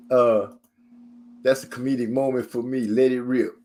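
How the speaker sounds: usual speaking voice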